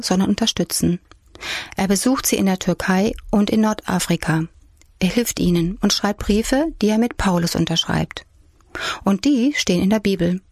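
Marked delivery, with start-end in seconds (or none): none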